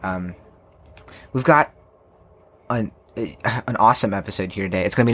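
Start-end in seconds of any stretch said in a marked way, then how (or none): none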